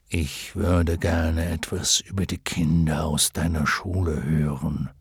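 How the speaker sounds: low, measured dragon voice; dignified luck dragon. Acted. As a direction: speak low and unhurried as a wise dragon. (würdevoller Glücksdrache, tiefe Stimme)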